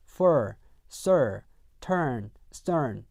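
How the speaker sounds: fur, sir, turn, stern said slowly with a strong American r-colored vowel; r-colored vowel demo. Shown as a demonstration. Teacher modeling example words for the class, slow r-colored vowels. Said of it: "사운드가 들어간 단어들이 뭐가 있냐면요".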